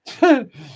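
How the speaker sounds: high, loud laugh; sudden real laugh; burst of laughter. Real, not acted. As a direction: laugh out at the comment. Reacting to a chat comment.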